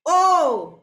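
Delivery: loud, drawn-out "ઓ"; sound demonstration. Shown as a demonstration. Teacher repeats the vowel for pupils, long loud "ઓ". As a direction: hold "ઓ" as a long o, loudly. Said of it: "શું થાય?".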